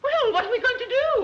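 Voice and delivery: high, loud fluttery alarm; flustered panic. Acted. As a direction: squeal it in a fluster. Mother panics over stolen pearls.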